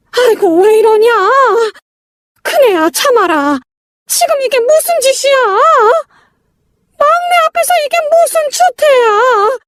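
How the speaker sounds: plead, then scold in a tearful high voice; distressed mother, pleading. Acted. Mother stopping her elder son from hitting his brother, distressed pleading.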